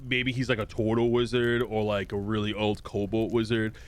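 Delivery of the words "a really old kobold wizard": ordinary talk, nothing marked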